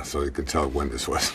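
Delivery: ordinary delivery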